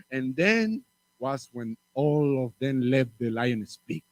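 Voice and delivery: ordinary delivery, nothing marked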